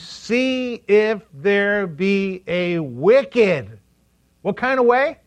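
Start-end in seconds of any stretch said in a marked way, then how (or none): 0.0-3.8 drawn-out solemn recitation; slow scripture quote. Acted. Preacher quotes the psalm slowly to the congregation. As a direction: stretch each word of the verse.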